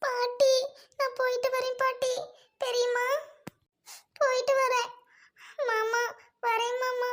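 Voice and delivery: high, tearful pleading through sobs; softly sobbing farewell to the family; crying goodbye. Acted. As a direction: choke out a goodbye while weeping. Tearful farewell leaving home.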